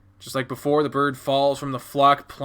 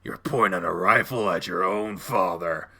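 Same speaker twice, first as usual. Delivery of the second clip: low, controlled fatherly reproach; grave menace; 6 semitones lower than usual. Acted. Dad staring down child's rifle, low grave menace.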